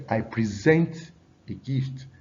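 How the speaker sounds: slow, present as pre-SENT; stress on second syllable. Shown as a demonstration. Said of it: "stress on the second syllable".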